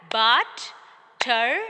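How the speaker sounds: "butterfly" as but... ter... fly, loud and slow; slow, separate beats on "butterfly"; syllable by syllable. Shown as a demonstration. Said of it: "By clapping".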